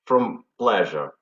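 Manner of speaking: ordinary talk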